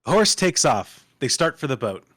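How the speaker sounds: ordinary talk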